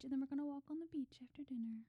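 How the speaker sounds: fast, hushed whisper. Real, not acted. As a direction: whisper it like a secret plan. Sharing romantic anniversary plans.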